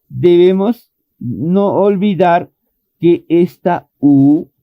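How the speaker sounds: normal talking voice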